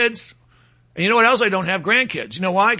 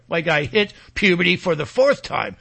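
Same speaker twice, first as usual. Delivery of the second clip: usual speaking voice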